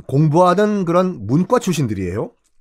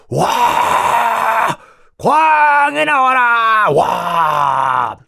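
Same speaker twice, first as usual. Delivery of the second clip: battle cry; 7 semitones higher, much slower than usual. Acted. Rebel army storming the palace, roaring battle cry. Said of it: "함성을 지르면서".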